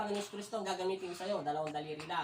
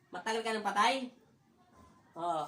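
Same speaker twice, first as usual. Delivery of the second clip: normal talking voice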